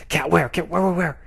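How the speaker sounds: lightly voiced cartoon dog acting all tough. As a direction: bluster as if ready to fight. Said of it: "he goes".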